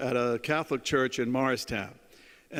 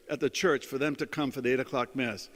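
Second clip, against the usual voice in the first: ordinary talk, nothing marked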